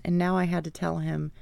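ordinary delivery, nothing marked

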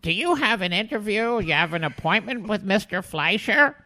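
put-on high, prim old lady voice; old secretary. Old secretary questioning the unannounced fan, high prim voice. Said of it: "And she goes".

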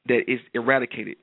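normal talking voice

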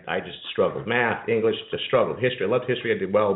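usual speaking voice

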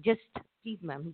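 ordinary talk, nothing marked

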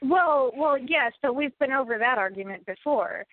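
ordinary delivery

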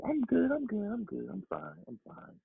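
childlike impression; imitating daughter. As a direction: voice a child's polite reply, starting high and trailing off quiet. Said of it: "This is how she's talking to me".

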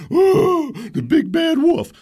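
high, loud mock taunt, slowed; hammed-up mocking of his critics; mocking crowd. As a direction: sneer it like the jeering crowd. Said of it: "And everybody said".

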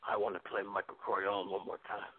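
ordinary talk, nothing marked

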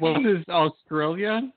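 slow, drawn out, rising question; slow unsure guess. Real, not acted. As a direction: ask it like you're not sure.

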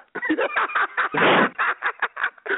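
high breathless wheeze of laughter; wheezing laugh. Real, not acted. Recalling the coach's pitch, breaks into a wheezing laugh. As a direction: let out a helpless high wheeze. Laughing at his own story.

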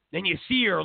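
ordinary talk

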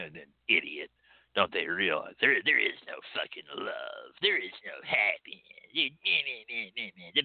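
low, muttering contempt for the clients; mock jaded cynic. A hoodoo worker mocking clients behind their backs, low muttered sneer. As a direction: sneer it like a burnt-out reader who scorns everyone. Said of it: "just going".

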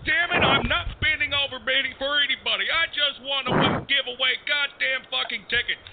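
high, furious ranting; angry rant. Acted. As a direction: rant in total frustration. DJ rants at staff.

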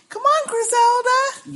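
put-on high, slow little-girl voice; little girl calling her doll, high and slow; imitating Lizzie. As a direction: coo it like a creepy child to her doll. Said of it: "did you hear her say".

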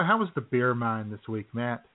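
ordinary delivery

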